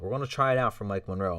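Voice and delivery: ordinary talk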